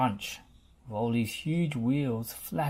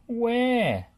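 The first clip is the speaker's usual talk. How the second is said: high, loud puzzled question; 10 semitones higher, 6 dB louder than usual. A narrator's reading. Rolly puzzled why everyone waves, high and loud. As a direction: ask it baffled. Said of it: "puzzled Rolly".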